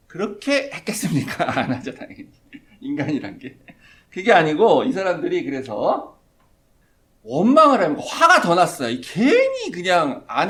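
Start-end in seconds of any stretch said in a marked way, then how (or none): none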